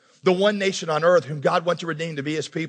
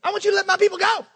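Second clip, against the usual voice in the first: much higher and fast, timid squeak; mousy Moses; 12 semitones higher, much faster than usual. Retold or imitated. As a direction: rush it out in a thin nervous voice. Voicing weak Moses, fast.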